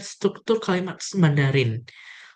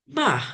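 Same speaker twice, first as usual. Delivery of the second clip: Ma falling sharply from high; fourth tone; 8 semitones higher than usual. Shown as a demonstration.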